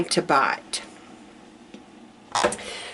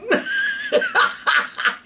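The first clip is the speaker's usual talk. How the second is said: high squealing laugh. Real, not acted. Laugh after impression.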